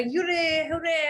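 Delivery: hoog gejuich, speels nagedaan; mock cheer. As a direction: juich het als een blije winnares. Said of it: "and you were like".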